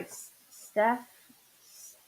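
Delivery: stuck repeating the s sound; halting stutter on the name. Real, not acted. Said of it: "I'm starting to stutter".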